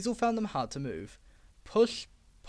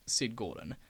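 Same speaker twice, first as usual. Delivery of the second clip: plain reading voice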